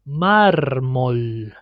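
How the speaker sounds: "mármol" with a long trill closing "már"; drawn-out "mármol", R fully trilled; multiple R. Shown as a demonstration. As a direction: slowly roll the R in "mármol". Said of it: "we say it multiple".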